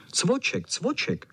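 high, yappy calls; barking dogs. A narrator's reading. All dogs calling for Cvoček, high barking. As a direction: bark the name out high, twice. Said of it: "štěkali všichni".

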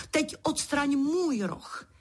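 high, slow, insistent demand; exclaiming. A narrator's reading. Queen orders Pedro to remove her horn, exclaiming. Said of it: "zvolala královna".

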